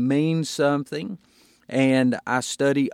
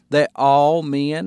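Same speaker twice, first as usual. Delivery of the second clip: "That all men" slow and loud, "all" punched; stress on all; 5 dB louder, slower than usual. Shown as a demonstration. Pastor underlining the word all for his hearers, emphatic. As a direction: hit the word all hard. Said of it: "I would underline the word all".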